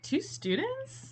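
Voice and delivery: high, slow disbelieving question; incredulous. Retold or imitated. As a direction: scoff at the idea in disbelief. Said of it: "I would probably be like".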